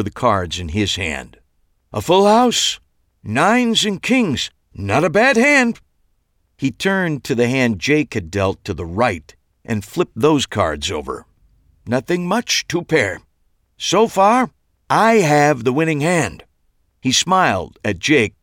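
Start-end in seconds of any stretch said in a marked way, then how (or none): 1.9-5.8 high, loud theatrical card reveal; showman reveal. A narrator's reading. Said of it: "Max reveals cards on stage". 11.9-16.4 showman reveal. A narrator's reading. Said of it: "Max continues his patter".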